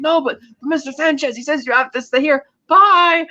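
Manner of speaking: a hint of a panicked servant pleading; imitating guard. Guard and Lupe at the dock.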